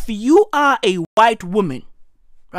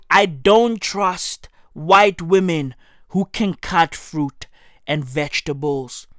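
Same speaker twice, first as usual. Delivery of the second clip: normal talking voice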